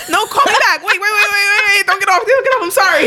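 high, loud desperate begging; frantic high pleading. Acted. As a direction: beg him not to hang up. Pleading phone call acted out.

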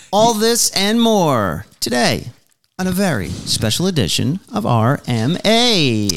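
upbeat show host intro; announcer voice. Acted. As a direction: open the show like a radio host. Podcast intro read.

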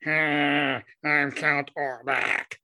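slow, drawn-out vampire voice; Count Orlok. Acted. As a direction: put on a slow Nosferatu voice. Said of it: "'Cause that's what he sounds like".

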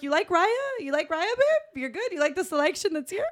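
high-pitched teasing string of questions; mock coy questioning. Woman quizzes her Raya date on the selection, mock coy. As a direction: needle him sweetly, each question lifting.

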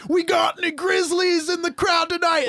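high, shouted stage call; country singer. Acted. Country singer rousing the grizzly fans, hammed-up shout. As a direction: yell it to a whole arena as a country singer. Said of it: "I'm the country singer now".

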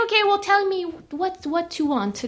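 light mock Chinese accent; a hint of a Chinese accent. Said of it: "This is my Chinese accent".

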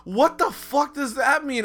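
high, loud, slow disbelief; baffled outburst. Retold or imitated. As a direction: spell out the confusion word by word. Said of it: "I'm like".

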